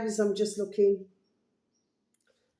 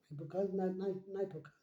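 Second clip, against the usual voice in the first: ordinary talk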